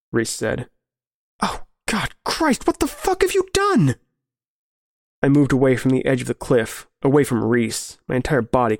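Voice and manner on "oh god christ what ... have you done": high-pitched, appalled outburst; horrified cry. Acted. Narrator to old friend over the bodies, horrified cry. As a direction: recoil in horror, voice leaping up high.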